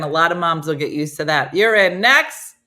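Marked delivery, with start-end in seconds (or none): 1.5-2.5 casting verdict. Acted. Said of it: "They're like".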